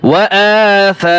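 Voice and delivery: "আ" and "সা" held long on a level chanting note; elongated vowels. Shown as a demonstration. Teacher showing learners where to stretch "আ" and "সা", elongated. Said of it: "টান হবে".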